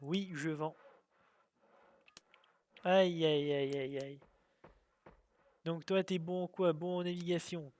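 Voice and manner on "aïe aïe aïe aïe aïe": pained lament. Real, not acted. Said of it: "ça me fait mal".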